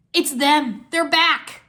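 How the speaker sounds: loud, rising joy; exclaiming. A narrator's reading. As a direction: announce a long-awaited return. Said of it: "Wu exclaimed".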